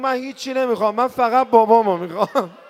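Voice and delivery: loud, high shouted cry; a child's shout retold at full force; sudden shout. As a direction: burst out and yell it. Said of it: "یهو داد زد".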